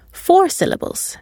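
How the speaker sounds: ordinary delivery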